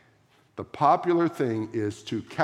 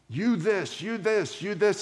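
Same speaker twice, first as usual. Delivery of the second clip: mock angry yelling; 7 semitones higher than usual. Pastor imitating an angry pro-choice heckler, mock yelling. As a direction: parody an angry heckler jabbing at him. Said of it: "screaming and yelling at me".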